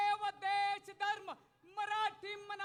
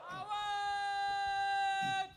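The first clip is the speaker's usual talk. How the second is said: long stretched rally shout; drawn-out shout; 3 dB louder, much slower than usual. Real, not acted. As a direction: stretch the word into a roar. Announcer calls crowd to roar. (लांबवलेली आरोळी)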